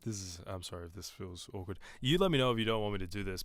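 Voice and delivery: ordinary talk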